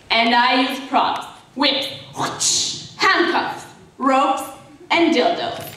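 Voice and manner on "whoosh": sharp whooshing whip crack. Acted. Listing her props, an imitated whip whoosh. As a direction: imitate a whip cutting the air.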